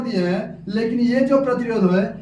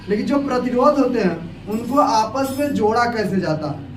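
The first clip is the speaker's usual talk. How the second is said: normal talking voice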